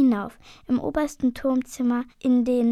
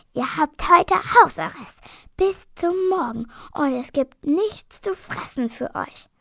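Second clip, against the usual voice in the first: furious innkeeper, louder and higher; angry shout; 4 semitones higher, 4 dB louder than usual. A narrator's reading. Der Wirt bestraft die Drachen, wütend schreiend. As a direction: bellow the punishment. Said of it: "Wütend tritt der Wirt ... ruft".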